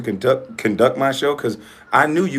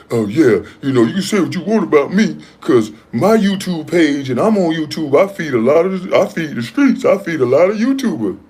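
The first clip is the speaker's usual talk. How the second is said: higher-pitched mocking imitation of a rival; mock braggart; 5 semitones higher, 3 dB louder than usual. Host quoting a rival boasting about his YouTube page, mocking imitation. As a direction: act out his boast in a raised, put-on voice. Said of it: "You said".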